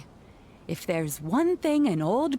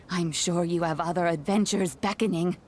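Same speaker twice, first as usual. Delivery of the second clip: ordinary talk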